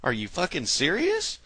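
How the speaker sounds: shocked high-pitched disbelief, dragged out; what, seriously; incredulous outburst. Retold or imitated. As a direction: play the stunned citizen who can't believe it. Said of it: "What I heard the American people doing were going".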